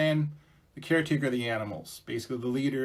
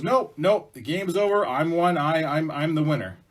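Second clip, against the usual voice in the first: loud, raised-pitch objection; insistent refusal; 4 semitones higher, 6 dB louder than usual. Acted. Beaver refusing to let Mouse play, insistent. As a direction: shut the newcomer out, loud and firm.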